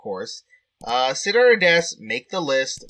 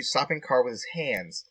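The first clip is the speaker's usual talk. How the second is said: ordinary talk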